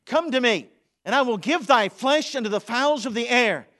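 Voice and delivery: loud, high booming giant; Goliath threatening David; Goliath's threat. Acted. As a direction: roar the taunt at David. Goliath's threat read aloud.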